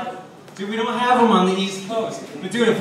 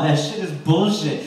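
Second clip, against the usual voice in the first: ordinary talk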